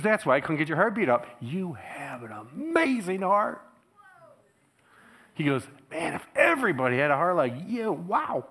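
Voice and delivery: high, loud praise; amazed doctor. Retold or imitated. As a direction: marvel at the heart.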